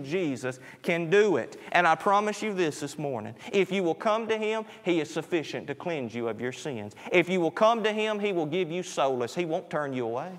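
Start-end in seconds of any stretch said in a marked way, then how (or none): none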